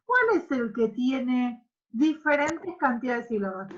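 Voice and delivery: normal talking voice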